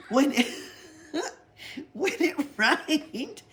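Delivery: laughing storyteller. Acted. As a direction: tell the absurd tale giggling. Pippi's tall tale, laughing.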